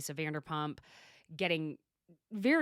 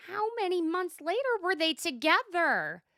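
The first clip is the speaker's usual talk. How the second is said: much higher, a touch louder, in Shannon's voice; imitating Shannon's question; 11 semitones higher, 4 dB louder than usual. Host mimicking Shannon asking the cast, much higher. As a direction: pitch it way up as a mock Shannon question. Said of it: "And Shannon asks".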